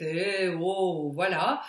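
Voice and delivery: voix large et plus forte; voix de nounours. Shown as a demonstration. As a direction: ouvrir le larynx comme un nounours. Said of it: "comme un nounours en fait". (voix de nounours bien large)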